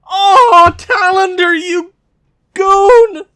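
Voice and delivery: high, loud yell at the goalie; furious shout. Real, not acted. Goalie lets in goal.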